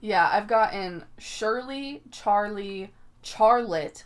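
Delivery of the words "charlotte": loud "Charlotte" starting like "char" in charcoal; mock mispronounced "Charlotte", CH instead of SH. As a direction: say Charlotte with a hard CH as in "chair". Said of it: "with just a ch".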